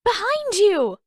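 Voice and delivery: high, loud yell of warning; urgent yelled warning. A narrator's reading. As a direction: scream it to warn him. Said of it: "Katara yelled".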